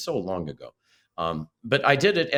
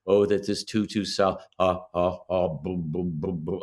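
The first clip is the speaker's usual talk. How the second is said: voice exercise; 3 semitones lower than usual. Shown as a demonstration. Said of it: "I just did all the exercises".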